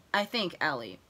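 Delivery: normal talking voice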